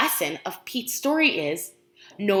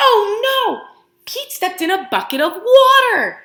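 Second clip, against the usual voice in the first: high, loud storybook alarm; dramatic oh no; 9 semitones higher, 9 dB louder than usual. Acted. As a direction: gasp it out to the kids. Reading dramatic story moment.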